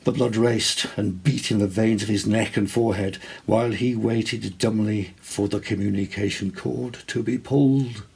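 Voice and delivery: ordinary delivery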